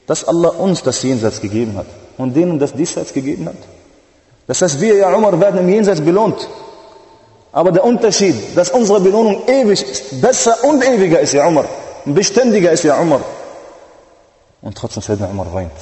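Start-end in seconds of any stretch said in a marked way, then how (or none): 4.5-13.2 high, loud tearful plea, slowing on the kings; fervent pleading preaching. Acted. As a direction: plead with the Prophet at full force. Prophet consoles Umar.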